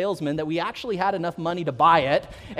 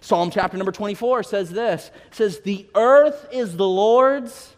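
loud, slow and rising, much louder than the story; full-force preaching; 2 semitones higher, 6 dB louder, slower than usual. Acted. Pastor proclaiming God's ownership to the church, full-force preaching. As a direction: preach it out to the whole congregation.

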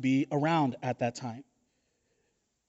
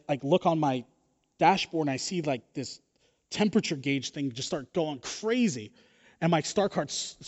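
ordinary talk, nothing marked

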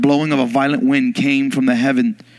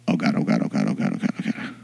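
panicked fading mutter; 8 dB quieter, faster than usual. Retold or imitated. Waiting alone, panicking.

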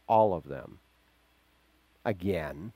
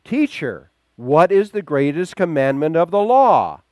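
much higher and louder, demanding; loud challenging question; 8 semitones higher, 14 dB louder than usual. Retold or imitated. A desperate Pharisee challenges Jesus, loud demanding question. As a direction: call out the test question sharply. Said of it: "Then one of them".

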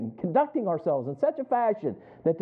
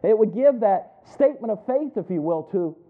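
louder, building exhortation; preaching; 2 semitones higher, 4 dB louder than usual. Acted. A preacher urges the congregation to honor Christ, fervent. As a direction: drive the point home to the congregation.

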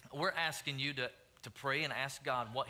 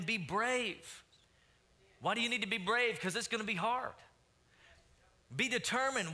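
rising, louder preaching build; 5 semitones higher, 3 dB louder than usual. Real, not acted. Pastor urging the church to be brave and determined, fervent preaching. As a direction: preach it higher and harder, calling them on.

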